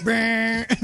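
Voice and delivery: a game show buzzer brrr; buzzer imitation. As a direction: buzz it like a wrong-answer buzzer. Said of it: "sounds like a game show buzzer going".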